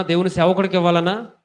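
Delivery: normal talking voice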